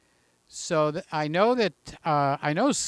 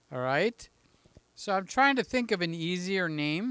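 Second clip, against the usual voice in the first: ordinary talk, nothing marked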